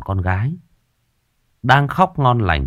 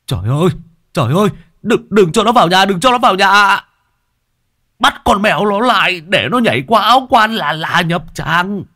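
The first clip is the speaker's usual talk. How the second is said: high, loud panicked cry; terrified old man; 10 semitones higher, 4 dB louder than usual. A narrator's reading. Old man crying out as the cat nears the coffin, panicked. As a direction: beg them in terror to stop the cat. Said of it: "Có ông già rú lên một cái".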